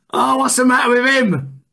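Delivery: loud and high mock exasperation; mock exasperated. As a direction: throw up your hands at him, loudly.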